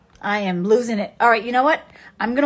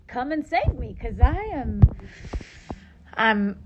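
usual speaking voice

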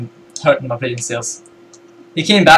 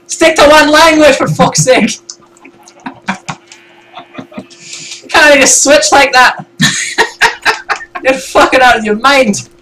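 high-pitched exasperated Scottish rant; Scottish accent; 9 semitones higher than usual. Acted. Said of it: "your Scottish accent just".

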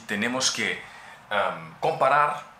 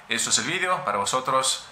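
ordinary talk, nothing marked

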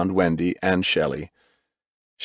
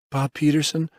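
higher, questioning call; insistent; 7 semitones higher than usual. A narrator's reading. Medic rousing the injured man, insistent. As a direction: call his name insistently, pitched up. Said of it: "The voice was insistent".

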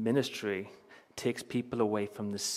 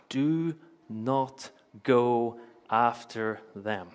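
very slow, word by word; weighty, drawn-out scripture quote; solemn quoted command; much slower than usual. Acted. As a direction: space out every word of the command.

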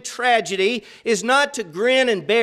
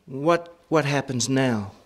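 imitating fear; 10 semitones lower, 4 dB quieter than usual. Preacher voicing a frightened believer, lower and softer. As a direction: lower and softer, a mildly fearful question. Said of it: "the third is fear".

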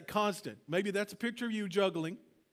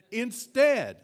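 emphatic preaching; 4 semitones higher, 9 dB louder than usual. Real, not acted.

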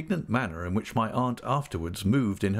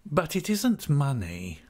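slow, slightly higher toady's voice; fawning Uriah Heep; 6 semitones higher, slower than usual. Acted. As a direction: draw it out with humble emphasis.

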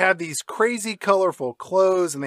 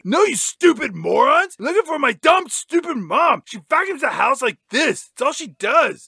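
high-pitched bratty mock rant; exasperated kid hero; 8 semitones higher than usual. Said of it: "And he's like".

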